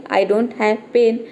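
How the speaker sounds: "I don't have pain" said very slowly, word by word; slow example sentence. Shown as a demonstration. Teacher slowly voicing the friend's excuse "I don't have pain". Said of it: "मेरे पास पेन नहीं है".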